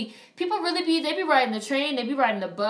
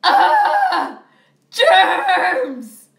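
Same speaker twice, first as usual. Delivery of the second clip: much higher, much louder hammed-up shriek; panicked scream; 12 dB louder, much slower than usual. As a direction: shriek at the screen in mock terror of germs. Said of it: "I was sitting in the movie theater like".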